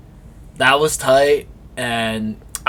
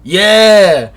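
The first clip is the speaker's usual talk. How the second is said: a loud, high burst of yes, much louder; excited yeah; 8 semitones higher, 11 dB louder than usual. Real, not acted. Surprised co-host asks what, loud excited yeah. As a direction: shout it out, thrilled to reveal.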